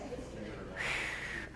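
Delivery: long, soft exhale; demonstrative sigh. Shown as a demonstration. Speaker showing what to do when frustrated, long sigh. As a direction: let out a slow sigh.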